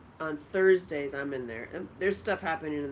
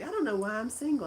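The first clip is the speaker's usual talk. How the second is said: light mock-whiny friend, a bit higher and quick; teasing impression of friend; 6 semitones higher, faster than usual. Teasing her friend Heather for viewers, a light mock-whiny voice. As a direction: gently mimic her friend's complaint. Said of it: "make fun of her".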